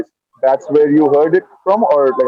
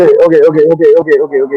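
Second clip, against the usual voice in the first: hurried interrupting okays; 7 dB louder, much faster than usual. Real, not acted. Cutting off a long plot summary.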